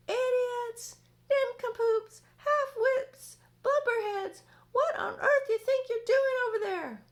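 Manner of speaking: very high, very loud taunting yell; yelling insults. Acted. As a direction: scream insults across the sky. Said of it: "yelling at the cloudmen as loud as he could".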